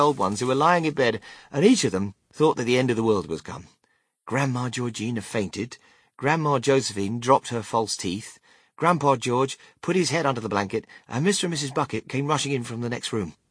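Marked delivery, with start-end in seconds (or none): none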